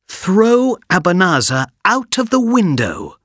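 high, loud command; commanding boy. Acted. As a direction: order the genie firmly. Aladdin commands genie.

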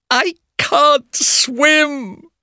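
slow, high, crying wail; mock tearful whine; sadly. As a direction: sob it out in defeat. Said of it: "she said sadly".

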